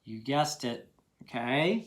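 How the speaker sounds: normal talking voice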